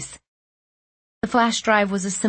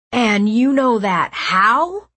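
slow, louder suspicious question; demanding; 4 semitones higher, 5 dB louder, slower than usual. A narrator's reading. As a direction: press him for the truth. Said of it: "Liam demanded".

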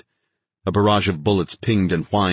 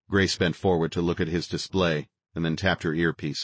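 plain reading voice

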